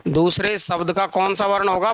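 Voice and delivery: ordinary talk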